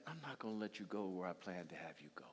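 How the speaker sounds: low, soft, grave decree; God's stern verdict. Acted. God judging the disobedient Israelites, low and grave. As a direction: pronounce the sentence quietly. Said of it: "God said".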